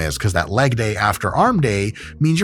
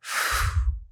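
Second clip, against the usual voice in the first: weary sigh; 8 dB quieter than usual. Pretend. Weary reaction to the ad.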